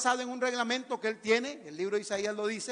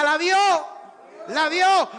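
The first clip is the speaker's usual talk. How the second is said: loud, high rallying shout; preacher's call-out yell; shouting with congregation; 8 semitones higher, 12 dB louder, slower than usual. Shown as a demonstration. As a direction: shout it out for the crowd to echo. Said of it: "alguien grite conmigo".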